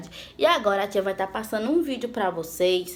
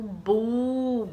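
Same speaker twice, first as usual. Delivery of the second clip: BU drawn out on its own; stressed syllable. Shown as a demonstration. Teacher giving children the answer, bu held long. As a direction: hold the vowel of bu. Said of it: "Qual é a sílaba?".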